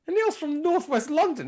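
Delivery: high-pitched, incredulous retold reply; incredulous correction. Friend's surprised correction, retold.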